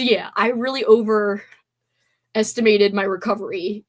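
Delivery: ordinary delivery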